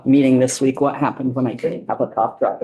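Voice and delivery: ordinary delivery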